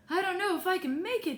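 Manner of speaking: high, quick protest; hurried, high-pitched worry; worried doubt. A narrator's reading. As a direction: fret about making the climb. Dad doubts he can climb.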